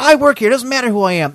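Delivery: high-pitched indignant employee; imitated scolding lady. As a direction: act out her high, huffy comeback.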